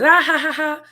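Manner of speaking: high, loud mocking laugh imitation; imitating class laughter. Teacher imitating students laughing at a wrong answer, mocking laugh. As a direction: mimic the kids laughing at a classmate's mistake. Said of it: "students laughing".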